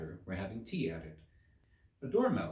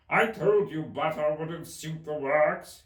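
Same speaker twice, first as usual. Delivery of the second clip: angry Hatter; 7 semitones higher, 8 dB louder, slower than usual. A narrator's reading. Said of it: "looking angrily at the March Hare".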